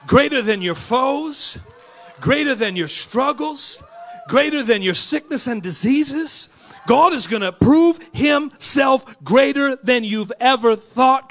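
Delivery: loud, high, building shout; preaching at full force. Real, not acted. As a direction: drive it home to the congregation. Preaching climax.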